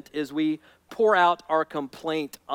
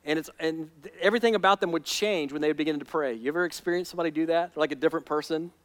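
ordinary talk

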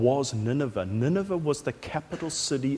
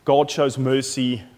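ordinary talk

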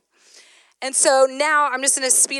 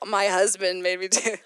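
laughing; 4 semitones lower, 3 dB quieter than usual. Real, not acted. Embarrassed at the dentist.

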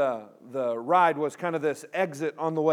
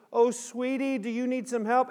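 higher-pitched kindly granny voice; sweet old grandma; 6 semitones higher than usual. Retold or imitated. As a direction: offer help like a sweet little grandma. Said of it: "this sweet little old grandma".

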